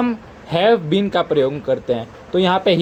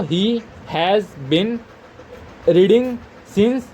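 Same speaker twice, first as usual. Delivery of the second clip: word by word, very slow; dictating one word at a time; slow dictation; much slower than usual. Shown as a demonstration. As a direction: read it out so students can write along. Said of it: "teacher dictating sentence word by word".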